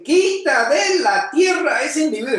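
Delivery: shouted with exclamation; exclaimed shout. Shown as a demonstration. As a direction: shout it like an angry mob. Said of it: "Cuando hay un símbolo de exclamación".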